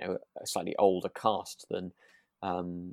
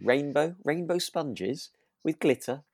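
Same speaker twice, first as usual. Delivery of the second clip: higher and slow, savouring each word; playfully thrilled, drawn out; mock delight; 6 semitones higher, 4 dB louder, slower than usual. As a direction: gush over the rainbow sponges. Gushing mock delight over cake.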